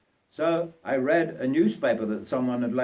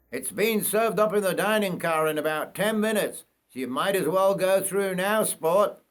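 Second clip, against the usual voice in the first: higher-pitched steward voice; steward offering breakfast; 7 semitones higher than usual. Acted. Steward offers the boy breakfast, higher voice. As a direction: voice the steward a good bit higher. Steward directs him to dining car.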